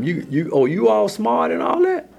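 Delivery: high-pitched, incredulous questioning as God; indignant challenge. Acted. God confronts those who challenged his servant, indignant. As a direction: challenge them, voice climbing high.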